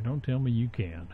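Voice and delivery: ordinary talk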